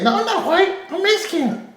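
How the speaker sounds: high, laughing mock voice; squeaky laughing impression of the woman; mocking her. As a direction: parody her prim correction.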